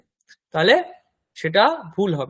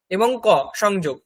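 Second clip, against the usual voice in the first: ordinary talk, nothing marked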